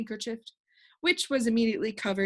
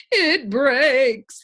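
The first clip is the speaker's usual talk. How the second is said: louder, breathless delight; joyful gasp; 4 semitones higher, 8 dB louder than usual. A narrator's reading. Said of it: "he gasped joyfully".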